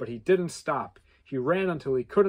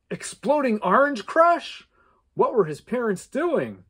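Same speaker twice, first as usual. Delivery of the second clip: alarmed; 9 semitones higher, 7 dB louder than usual. A narrator's reading. Alarm at smoke.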